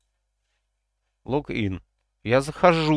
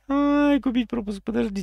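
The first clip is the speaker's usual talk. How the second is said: high excited squeal; 6 dB louder than usual. Real, not acted. Finds the pass option.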